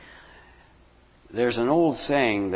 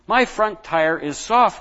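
cried; 6 semitones higher, slower than usual. Acted. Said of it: "he cried".

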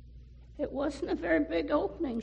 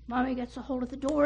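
plain reading voice

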